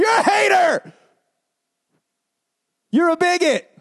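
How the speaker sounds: high, loud mock accusation; mock accusing shout. Accusing him mockingly as a scornful critic, high and loud.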